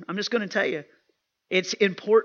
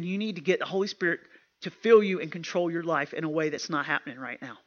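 ordinary talk

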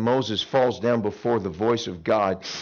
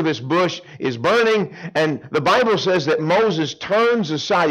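usual speaking voice

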